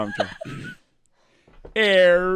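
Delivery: high ringing air. Shown as a demonstration. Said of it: "I'm gonna try".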